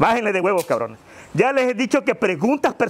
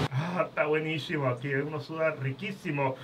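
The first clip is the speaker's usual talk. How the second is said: contented sauna murmur; 7 semitones lower, 10 dB quieter than usual. Acted. Relaxing in sauna heat.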